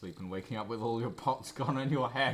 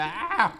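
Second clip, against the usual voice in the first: high, loud startled "ah"; startled yelp; 11 semitones higher, 11 dB louder than usual. Acted. Boy loses his frog, startled high yelp. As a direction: yelp as something slips away.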